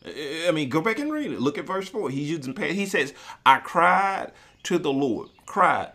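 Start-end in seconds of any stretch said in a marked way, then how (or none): 3.4-5.9 cried said loud and slow; stress on cried. Shown as a demonstration. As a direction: punch the word cried to show past tense. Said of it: "past tense".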